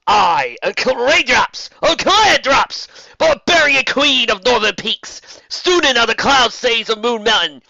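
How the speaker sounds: high, loud, proud proclamation; heroic declaration. Acted. Barbarian queen proclaims her titles to the enchantress, loud and high. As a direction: announce every title like a war banner. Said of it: "declared Raindrops".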